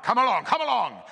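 loud, high urging; urgent cry. A narrator's reading. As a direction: cry out to hurry them on. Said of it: "Longridge cried".

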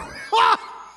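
big loud laugh, high; roaring laugh. A narrator's reading. Strongman laughs at the dwarf's threat, roaring. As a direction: laugh in mockery. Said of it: "drowned her out with a roar of laughter".

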